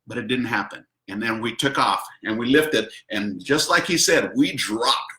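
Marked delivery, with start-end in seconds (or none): none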